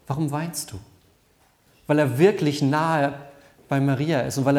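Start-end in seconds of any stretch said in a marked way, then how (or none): 0.0-0.8 softer, full of empathy; compassionate. Shown as a demonstration. Jesus asks the weeping Maria at the grave, tender. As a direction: ask her gently, close to her. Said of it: "mit ganz viel Empathie, mit Mitgefühl gefragt".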